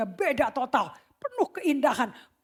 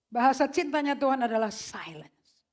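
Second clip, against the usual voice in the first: ordinary talk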